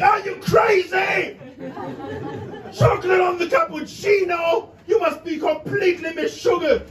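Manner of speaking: hammed-up shocked outrage; mock outraged shout. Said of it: "to him, it sounded like".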